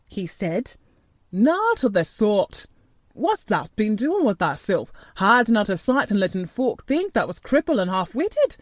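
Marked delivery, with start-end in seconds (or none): 1.3-8.6 put-on gruff old gardener, broad Yorkshire, moved; an old countryman blessing the boy; lightly voiced old gardener in broad Yorkshire. A narrator's reading.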